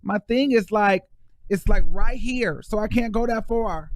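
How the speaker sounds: high-pitched, playful celebrity rapper voice. Pretend. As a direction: keep it up high in a mock pop-diva voice. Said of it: "in my [name] voice".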